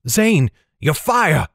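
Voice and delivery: high, slow-stretched call to an ally; urgent call. A narrator's reading. Heroine calling ally for fire, urgent cry. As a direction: call out high, drawing the words out.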